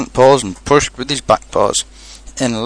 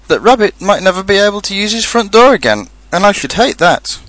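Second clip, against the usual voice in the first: high, mildly upset worry; a touch of tearful concern; sniffling slightly; 9 semitones higher than usual. A narrator's reading. Pooh fearing for Rabbit's front door, sniffling slightly. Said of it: "sniffling slightly".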